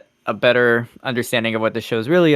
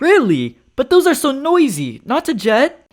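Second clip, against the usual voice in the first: talk show host; 12 semitones higher, slower than usual. Pretend. Host reacts.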